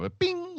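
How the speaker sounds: high chirpy imitation of a text alert; phone ding. Imitating phone notification sound.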